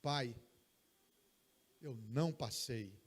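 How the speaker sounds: low, downcast confession of failure; dejected son. Acted. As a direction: hang your head as you say it. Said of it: "ele entra cabisbaixo e diz".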